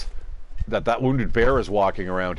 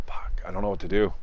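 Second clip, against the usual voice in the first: whispered curse under the breath; frustrated whisper; 3 semitones lower, 5 dB quieter than usual. Real, not acted. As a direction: hush it out, at a loss and fed up. Freezing, stuck without fuel.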